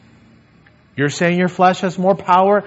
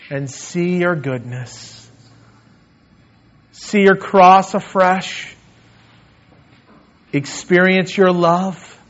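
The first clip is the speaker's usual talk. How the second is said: closing prayer. Acted. Pastor leading the congregation in closing prayer, earnest and pleading.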